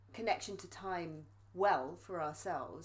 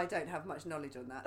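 normal talking voice

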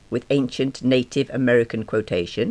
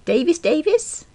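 puzzled repeat. A narrator's reading.

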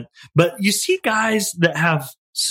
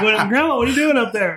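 higher-pitched mock surprise; retold bewildered question; 8 semitones higher than usual. Grandchild finds grandma atop the refrigerator, mock bewildered question. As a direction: act out puzzled disbelief, pitched up.

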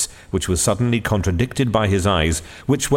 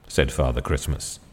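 plain reading voice

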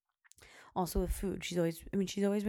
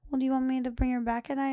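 higher, louder, quick question as her mom; imitating her mother; 7 semitones higher, 5 dB louder, faster than usual. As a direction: rattle it off like a worried grandma.

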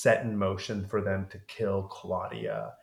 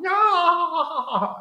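mock shriek; 7 dB louder than usual. Reacting to imagined vampire.

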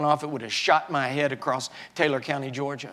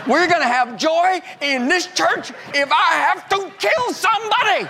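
angry preaching; 13 semitones higher, 5 dB louder than usual. Retold or imitated. Pastor mimicking his angry sermon on joy, furious shouting. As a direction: preach joy through clenched fury, shouting. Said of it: "angry man preach on joy".

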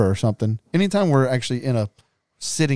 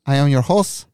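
lightly put-on English host voice, a touch higher; mock English accent; 4 semitones higher than usual. Hosting the segment for his cohost, a mild mock English accent. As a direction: announce it like a pompous English show host. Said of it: "use that accent the whole time".

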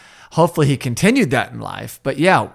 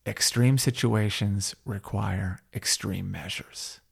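quoting Terry Silver; 5 semitones lower, 8 dB quieter than usual. Retold or imitated. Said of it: "the other line in question is".

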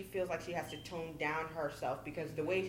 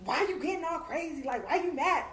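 mocking man; 9 semitones higher, 5 dB louder than usual.

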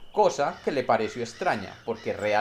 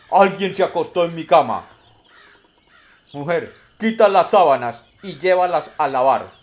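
big booming bear voice, much louder; papa bear; 4 semitones higher, 9 dB louder than usual. Acted. Papa bear finds his bed messed up, loud booming voice. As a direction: boom it out like an indignant father bear.

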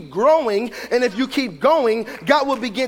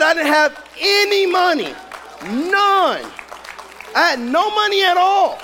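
high, raised preacher's cry; emphatic retold testimony; 6 semitones higher, slower than usual. Preacher quoting a woman with no money, emphatic. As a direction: push it up high, pausing between phrases.

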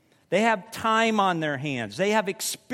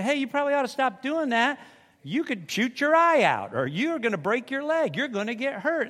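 higher, fast mock warning "hey, you probably ought to stop"; preacher voicing a friend who warns a reckless person, higher and urgent; imitating a warning friend; 6 semitones higher than usual. A concerned friend pleading, pitched up.

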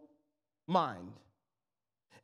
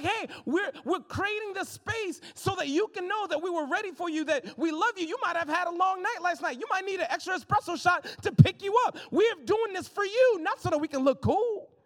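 higher, bright eager welcome; 6 semitones higher, 4 dB louder than usual. Pretend. Cafe team greeting a tired visitor, eager and higher. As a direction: make them feel expected.

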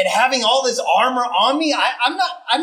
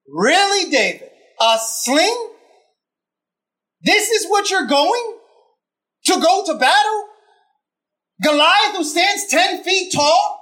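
incredulous; 7 semitones higher than usual. Acted. Mocking David's sling choice.

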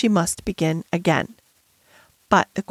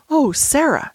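higher-pitched exclamation of a name; exclaiming; 5 semitones higher than usual. A narrator's reading. Miss Minchin caught off guard by Sarah, exclaiming. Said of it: "she exclaimed".

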